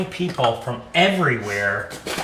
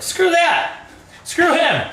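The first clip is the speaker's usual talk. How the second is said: high, louder mock yell; movie quote shout; 4 dB louder, slower than usual. Brother in Trading Places yelling over collapsed guy, mock shout. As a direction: bark it like the heartless brother. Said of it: "the brother's like".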